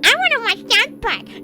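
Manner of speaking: high, nasal-free mocking imitation of a friend; lightly mocking a friend's demand; mock whiny friend. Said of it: "Yadi's like".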